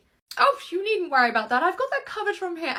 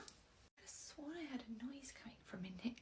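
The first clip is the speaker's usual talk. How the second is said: hushed, breathless whisper; creeping in, whispering to herself; 4 semitones lower, 24 dB quieter than usual. Acted. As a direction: whisper so nobody hears you. Sneaking in to check a noise.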